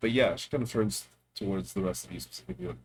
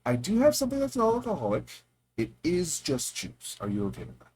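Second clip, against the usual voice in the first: high bright character voice; barkeep voice; 9 semitones higher, 4 dB louder than usual. Acted. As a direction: offer it kindly, in character. GM voicing Enka.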